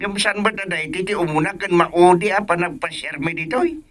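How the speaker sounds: soft, thin voice down a phone line. Acted. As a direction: sound like the caller on the other end. Other end of a phone call.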